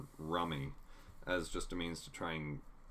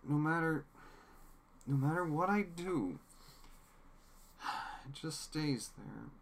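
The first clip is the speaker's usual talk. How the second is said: high, despairing pleading; a broken man who can't get the blood off; distraught; 9 semitones higher than usual. Retold or imitated. Newsom's breakdown quoted.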